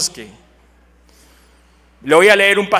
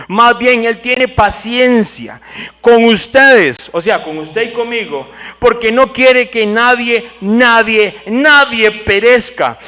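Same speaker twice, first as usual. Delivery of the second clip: louder preaching, pressing each phrase; full-force preaching; 3 semitones higher, 6 dB louder, slower than usual. Acted. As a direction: preach it out to the congregation.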